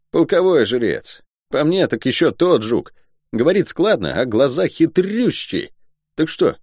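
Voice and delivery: high voice with drawn-out key words; excitable soldier pressing for news; talkative guardsman, gossiping. Acted. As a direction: gossip in a high, animated pitch. Guard Luk gossips about priest.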